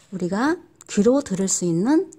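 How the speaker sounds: usual speaking voice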